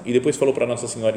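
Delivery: ordinary talk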